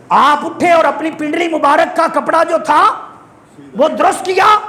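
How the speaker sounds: high, ringing sermon voice; fervent, high-pitched storytelling to a congregation; impassioned preaching. Real, not acted. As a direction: declaim each phrase up high from the pulpit. Preaches at full force.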